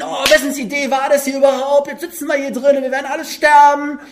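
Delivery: high, loud panicked quarrel; aggressive yelling. Acted. Donnersöhne shouting at each other in the storm, angry yell. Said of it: "haben sich angeschrien".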